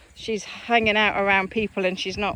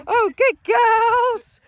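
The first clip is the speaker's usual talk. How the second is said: very high, loud, stretched praise; elated praise; 11 semitones higher, 8 dB louder, much slower than usual. Real, not acted. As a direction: gush over the dogs. Praising dogs after recall.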